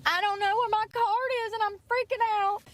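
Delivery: squeaky, frantic apologizing; panicked apology. Retold or imitated. Retelling apologizing at the drive-thru for losing her card, high panicked voice. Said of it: "I'm like".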